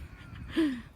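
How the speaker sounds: short high chuckle; amused giggle escaping mid-story; laughing. Real, not acted. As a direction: let a small, high laugh slip out. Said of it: "Sorry, I'm laughing".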